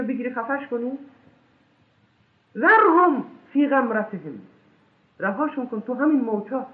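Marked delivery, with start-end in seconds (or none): 2.6-4.4 Quran recitation. Acted. Slow intoned Quran verse.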